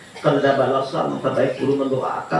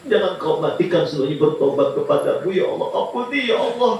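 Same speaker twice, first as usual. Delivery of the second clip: high, pleading prayer through mock tears; a preacher voicing the Prophet's tearful plea; weeping prayer; 7 semitones higher than usual. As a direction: beg God for forgiveness, half in tears. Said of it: "sambil menangis".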